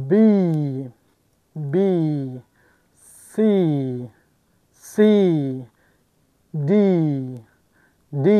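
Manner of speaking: form "B، C، D" with lips and tongue, one by one; isolated letter names "B، C، D", each clearly set apart; consonants with lips and tongue. Shown as a demonstration. Teacher showing blocked consonants, "B، C، D" made with lips and tongue.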